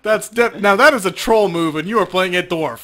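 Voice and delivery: high, loud laughing protest; laughing disbelief. Real, not acted. Game master laughing at the player guessing blindly, in high loud laughter. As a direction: laugh while calling out the troll move.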